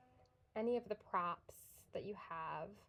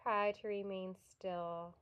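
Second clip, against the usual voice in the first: soft, unhurried yoga nidra guidance with long pauses; guided meditation; 6 dB quieter than usual. Real, not acted. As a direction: gently settle them into stillness, growing quieter.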